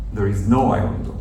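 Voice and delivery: ordinary talk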